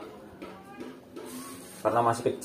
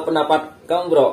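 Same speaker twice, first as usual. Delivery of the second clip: ordinary delivery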